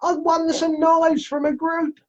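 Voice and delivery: squeaky, high mock celebration; excited winner. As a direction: imitate a thrilled prize winner in falsetto. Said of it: "we will have somebody going".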